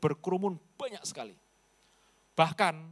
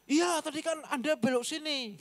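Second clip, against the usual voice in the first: villager voice; 10 semitones higher than usual. Retold or imitated.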